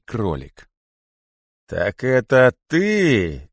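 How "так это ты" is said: slow, loud, menacing recognition; hissed accusation. A narrator's reading. Great python finally recognises the culprit, a slow accusing hiss. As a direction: draw it out slowly, pitch raised, accusing. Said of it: "наконец прошипел великий питон".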